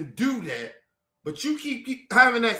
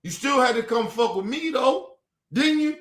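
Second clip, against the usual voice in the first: normal talking voice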